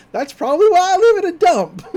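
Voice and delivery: high, loud cartoon old-man voice; McGucket. Old inventor answers the complaint about his invention, loud high cartoon voice. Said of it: "McGucket's like".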